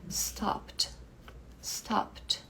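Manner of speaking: stopped whispered with the E dropped; stopped whispered as stopt. Shown as a demonstration. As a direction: breathe stopped out in a whisper, one syllable. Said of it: "o E cai, a gente vai direto pro D".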